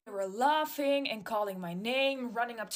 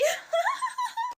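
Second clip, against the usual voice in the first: put-on very high mean-girl giggle; mocking laugh; 3 dB louder than usual. Bully girl laughing at the narrator.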